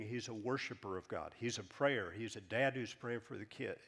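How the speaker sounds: ordinary talk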